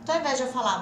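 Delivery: ordinary talk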